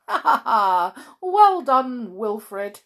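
loud acted chuckle into praise; chuckling. A narrator's reading. Mister Lazy much amused by Wilfred's trick, chuckling. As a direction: laugh out a pleased well done. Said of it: "he chuckled".

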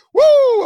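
high wild whoop; wrestler woo. Acted. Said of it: "I'm Lord Nelson mode".

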